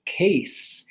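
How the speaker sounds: one-syllable case, high and clear; case, said clearly. Shown as a demonstration. Teacher modeling case for ESL students, clear. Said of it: "Whereas the next word".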